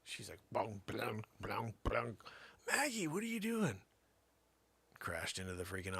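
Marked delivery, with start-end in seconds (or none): 0.5-2.2 soft bong noises; imitated clanking. Dryer clanking inside the dog, imitated softly. 2.7-3.8 much higher call; exasperated. Retold or imitated. Owner hearing the dryer inside the dog, high exasperated call.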